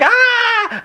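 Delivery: high laughing shriek; mock pain scream; imitated shriek. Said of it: "it's just this shrieking scream".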